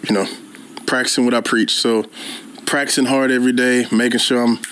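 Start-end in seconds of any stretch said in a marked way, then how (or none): none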